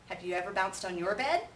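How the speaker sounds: ordinary delivery, nothing marked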